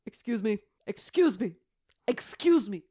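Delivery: loud, firm British guide; mock British tour guide cutting in; tour guide scolding. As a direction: shout politely over the noise. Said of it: "he was".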